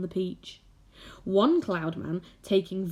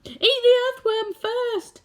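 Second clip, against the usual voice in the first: high, loud, slow bug voice yelling; centipede shouting; 6 dB louder than usual. A narrator's reading. Centipede yelling to the attacker, high loud shout. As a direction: bellow it out cheekily. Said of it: "shouted the centipede".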